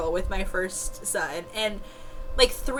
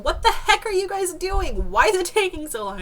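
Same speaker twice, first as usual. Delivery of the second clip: higher, louder mock yell at the staff; frustrated higher yell; retold yelling; 10 semitones higher, 4 dB louder than usual. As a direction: yell it in labor-pain frustration. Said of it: "And I kept yelling".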